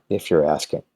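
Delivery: ordinary talk, nothing marked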